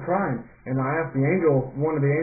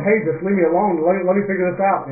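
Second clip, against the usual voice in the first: louder, higher retold protest; exasperated protest; 5 semitones higher, 6 dB louder than usual. Dreamer tells best friend to let him figure it out, exasperated. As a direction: push the interrupting friend away, raised voice.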